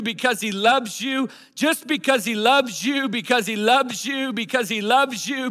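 high, loud, rhythmic repeated refrain; preacher's declaration. Real, not acted. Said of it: "I'm gonna declare this over every person".

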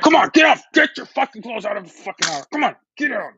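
mock furious order to get out; not-nice yelling. Acting out throwing a partner out, angry yell. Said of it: "Or you can just be nice".

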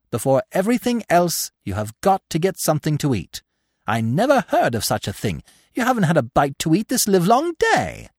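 put-on high, fussy old doe scolding the fawns; mother them like a bossy old aunt; busy, fussing elder doe.

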